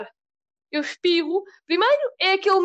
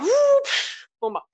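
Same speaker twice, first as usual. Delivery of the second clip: mock explosion; 10 semitones higher, much slower than usual. Slipper flying, mock sound.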